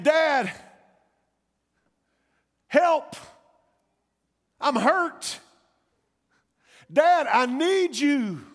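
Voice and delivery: loud, high-pitched cries for Dad; child's desperate cry. Acted. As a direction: call out like a hurt child, stretching the last plea. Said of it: "I hear the cries of one of my children".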